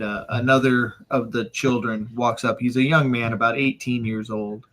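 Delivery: ordinary talk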